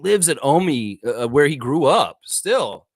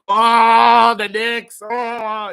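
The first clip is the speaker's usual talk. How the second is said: loud, high whoop of delight; loud, high gloating cry; gleeful gloating; 5 semitones higher, 8 dB louder, much slower than usual. Real, not acted. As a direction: relish the rival's loss out loud. Gloating over the Knicks.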